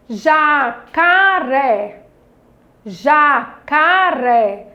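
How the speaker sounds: "jacaré" very slow, in three held syllables; careful loud pronunciation. Shown as a demonstration. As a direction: sound out ja-ca-ré for the class. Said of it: "Olha a pronúncia quando você". ("jacaré" bem pausado, sílaba por sílaba)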